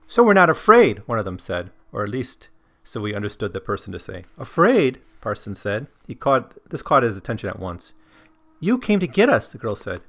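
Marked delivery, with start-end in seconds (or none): 0.1-1.0 high, loud character line; cheerful stranger in the story. Acted. Character voiced in read quote. 4.4-5.0 higher, louder questioning echo; startled echo. Acted. Parsons voiced in read quote. 8.6-9.5 put-on high girlish voice; lightly voiced girl, higher; girl in the story. Girl voiced in read quote.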